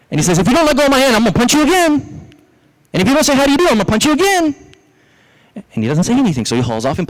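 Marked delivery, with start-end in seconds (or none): 0.4-4.5 very high-pitched, ranting threat; acted fury at the top of the voice; furious rabbit. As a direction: threaten like a stuck rabbit in a storybook rage. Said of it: "He's getting furious".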